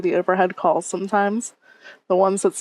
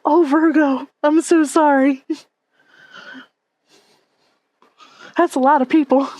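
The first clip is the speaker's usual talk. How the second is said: sympathetic; 7 semitones higher, 4 dB louder than usual. Real, not acted. Sympathizing with Virgo.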